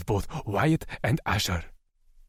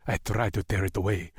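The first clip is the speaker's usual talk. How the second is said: plain reading voice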